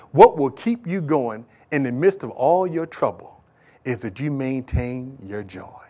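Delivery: normal talking voice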